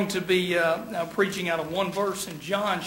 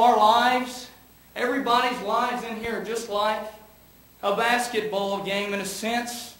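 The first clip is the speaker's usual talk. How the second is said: ordinary talk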